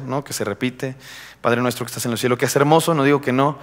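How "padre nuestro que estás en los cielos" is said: low, soft recital by rote; rote recitation. Acted. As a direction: say the prayer just for saying it. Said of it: "se dice solamente por decir". (recitado por decir, bajo y apagado)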